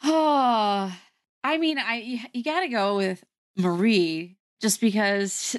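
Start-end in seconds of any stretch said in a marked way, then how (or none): none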